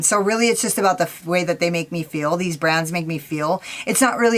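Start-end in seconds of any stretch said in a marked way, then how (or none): none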